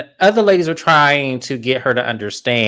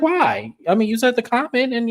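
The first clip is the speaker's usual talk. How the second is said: very high airy mock-innocent voice; high-pitched imitation of a castmate's defence; imitating Brittany; 13 semitones higher than usual. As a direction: play it high and innocent, as if puzzled. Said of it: "And Brittany was like".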